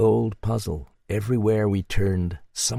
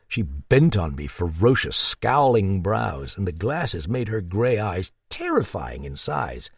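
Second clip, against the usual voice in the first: ordinary delivery, nothing marked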